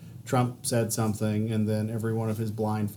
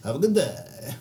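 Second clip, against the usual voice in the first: wife imitating; 3 semitones higher than usual. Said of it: "it's my wife saying".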